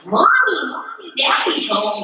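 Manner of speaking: high-pitched mock child's bad American accent; terrible American accent. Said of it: "It's like a terrible accent".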